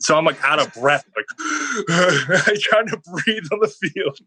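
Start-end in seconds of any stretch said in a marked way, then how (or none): 1.3-2.5 short high laugh. Real, not acted. Laughing on the field.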